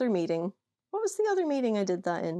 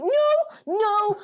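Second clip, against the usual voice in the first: much higher, louder pleading no; high protesting no; 11 semitones higher, 4 dB louder than usual. Retold or imitated. Friend insists to the room, high squealing no. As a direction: squeal the denial. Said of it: "she was like".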